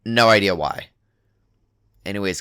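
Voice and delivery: usual speaking voice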